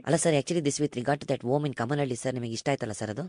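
low, soft, quick broker patter; meek broker. Acted. Prank broker greets customer, meek low murmur. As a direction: play a deferential broker on the phone.

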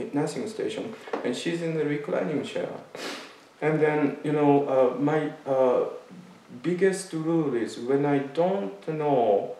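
ordinary talk

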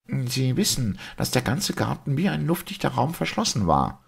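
high-pitched, confident doctor; challenging doctor. Acted. Doctor promising the priest two baffling questions, challenging. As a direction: pitch it high, ready to confound them.

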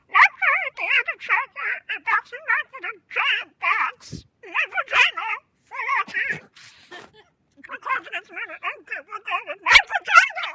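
put-on high voice-changed old woman reading; old lady voice changer. Shown as a demonstration. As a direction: read the card through an old lady filter. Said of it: "because of my old lady voice".

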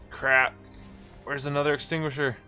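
ordinary delivery

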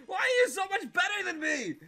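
high, loud mock-outraged shouting; outraged disbelief. Outthrown by a friend at can tossing, shouting in mock disbelief.

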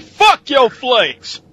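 loud, high, drawn-out mock rage; mock angry yell. Critic kicking Frosted Flakes, mock furious yell. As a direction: yell it slow, like kicking the box. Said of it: "I kick the box of Frosted Flakes like".

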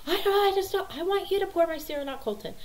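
high, fast mock tantrum; starts high and loud, eases off; imitated whining child. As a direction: mimic a five-year-old's protest. Five-year-old arguing over cereal.

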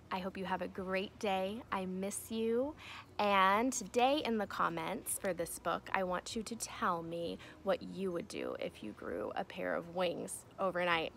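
usual speaking voice

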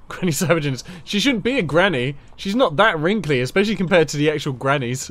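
loud, high amused protest; excited. Real, not acted. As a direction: protest it with a laugh. Laughing indignation about character.

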